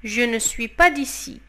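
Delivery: normal talking voice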